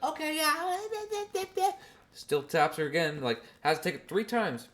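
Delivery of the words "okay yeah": very high brush-off; dismissive mom imitation. Mom brushing off the aunt, very high. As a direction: wave her off in a squeaky voice. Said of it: "she's like".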